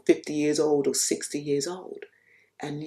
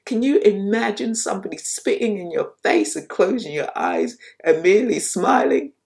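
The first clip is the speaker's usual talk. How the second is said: ordinary talk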